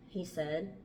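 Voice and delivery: plain reading voice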